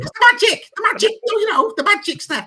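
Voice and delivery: very high, loud squeal on magic; giddy fan voice again. Retold or imitated. Host excuses his slip with the squealing fan voice.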